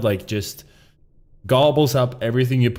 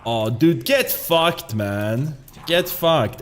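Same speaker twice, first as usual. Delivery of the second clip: ordinary talk, nothing marked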